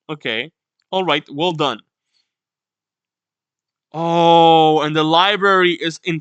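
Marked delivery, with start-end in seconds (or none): none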